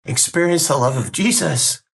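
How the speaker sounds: choked up, voice cracking high; fighting back tears mid-sentence; voice breaking. Real, not acted. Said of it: "there I go".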